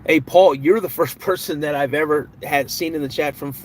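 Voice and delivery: ordinary delivery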